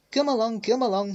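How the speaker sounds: high, loud puffing chant; puffing engine. A narrator's reading. James to the trucks, high puffing chant. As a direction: puff it out in rhythm. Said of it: "he puffed".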